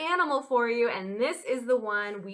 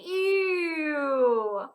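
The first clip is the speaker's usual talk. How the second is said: long drawn-out higher ew; playful disgust; 4 semitones higher than usual. Pretend. Teacher prompting children about skunk smell, exaggerated disgust. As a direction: stretch it out in mock revulsion. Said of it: "It does not smell very good".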